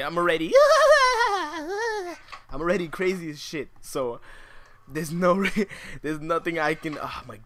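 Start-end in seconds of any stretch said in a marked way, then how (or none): 0.6-2.4 high shrieking laugh close to a cry; crazed laugh. Real, not acted. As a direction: cackle like you've lost it. Arrow in brain, gone crazy.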